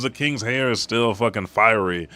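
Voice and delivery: usual speaking voice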